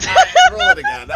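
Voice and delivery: high, fast, loud outburst; excited disbelief. Real, not acted. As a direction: blurt it in laughing shock. DM laughing in disbelief.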